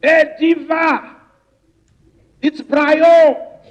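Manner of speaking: high, drawn-out calling shout; re-enacted shout. Calling up to a friend on the ninetieth floor, shouting high. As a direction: holler up to someone far away, stretching the words. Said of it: "Then I started shouting".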